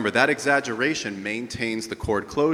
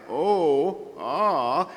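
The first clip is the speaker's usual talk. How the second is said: higher light ah with a cry; 7 semitones higher, 4 dB louder than usual. Shown as a demonstration. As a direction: float it up with a gentle cry. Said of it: "lighter production with less breath force and more cry".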